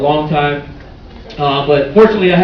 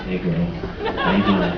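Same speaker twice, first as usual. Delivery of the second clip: very deep, soft seductive voice; sex voice; 12 semitones lower, 10 dB quieter than usual. Acted. Comic showing his sex voice to the crowd. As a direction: drop way down and purr it. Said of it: "That's my sex voice".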